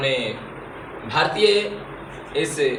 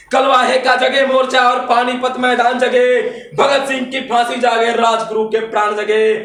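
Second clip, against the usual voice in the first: high, louder declamation of patriotic verse; fiery poetry recitation; 8 semitones higher, 7 dB louder than usual. Acted. Poet rousing the audience to wake India, fiery declamation. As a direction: declaim it as a rousing call to arms.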